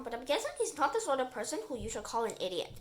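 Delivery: ordinary delivery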